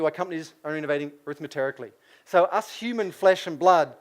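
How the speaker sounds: ordinary talk, nothing marked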